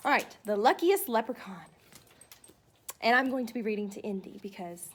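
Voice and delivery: ordinary talk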